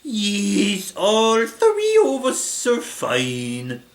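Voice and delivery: put-on slow, loud, high Italian accent; hammy Italian accent with stretched vowels; Ghidorah. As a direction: draw out every word, Italian-style. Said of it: "doing an Italian accent for Ghidorah".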